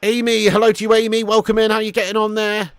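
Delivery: loud, high-pitched welcome; hearty greeting. Real, not acted. Streamer welcoming Amy into the chat, loud and high. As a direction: call out a big hello to a newcomer.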